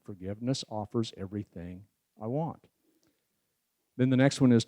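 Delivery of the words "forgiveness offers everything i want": "Forgiveness offers everything I want." spoken much softer and lower, evenly counted; quiet ten count. Shown as a demonstration. Speaker repeating the verse as a soft ten count. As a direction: hush it and let each beat land. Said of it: "Ten count".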